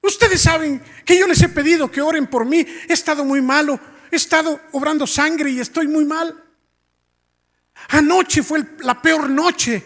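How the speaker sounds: high, loud, impassioned retelling; imitating tearful testimony. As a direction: act out Dad testifying through tears, without real sobs. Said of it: "estaba llorando cuando decía eso".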